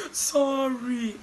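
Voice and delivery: high, drawn-out mock tearful apology; imitated crying. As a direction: stretch it into a pretend wail. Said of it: "when she was crying".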